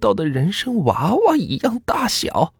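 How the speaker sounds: put-on high, slow old-woman voice; high-pitched, drawn-out granny character; old grandmother questioning. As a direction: draw the question out slowly as an aged granny.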